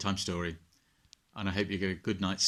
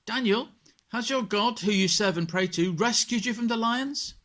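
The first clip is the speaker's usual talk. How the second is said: much higher and louder, calling out anxiously; king shouting; 14 semitones higher, 9 dB louder than usual. Acted. King Darius calling into the lions' den at dawn, anxious shout. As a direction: call down into the den with everything. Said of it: "he shouted".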